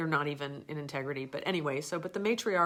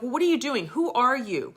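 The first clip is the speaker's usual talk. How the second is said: much higher, louder repeated demands; imitating a trainer barking questions; hammed-up angry questioning; angry interrogation; 9 semitones higher, 10 dB louder than usual. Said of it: "got really angry".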